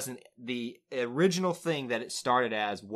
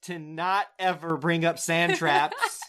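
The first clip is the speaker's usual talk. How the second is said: mock pleading; 5 semitones higher, 6 dB louder than usual. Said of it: "I'm begging you".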